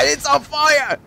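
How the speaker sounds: high, sudden alarm. Acted. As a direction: cry out as it ignites. Shovel catches fire.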